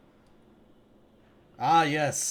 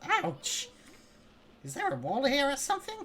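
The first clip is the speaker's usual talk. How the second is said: squeaky high voice; 4 dB louder than usual. Acted. Voicing hurt character.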